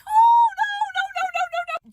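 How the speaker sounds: very high squealed string of refusals; panicked refusal. Retold or imitated. Seeing her name pinned on his phone, high panicked squeal. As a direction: squeal it in mock panic, starting loud.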